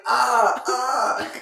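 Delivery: loud, high mock cries; imitated yelp. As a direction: cry out twice like someone just hit. Imitating the man hit, stumbling back.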